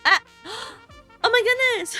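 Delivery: high excited squeal; cute anime streamer. Real, not acted. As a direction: squeal with delight at the raid. Raid arrives, excited squeal.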